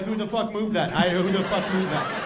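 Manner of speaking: panicking over a moved stash; mock paranoid yell. Paranoid user voiced.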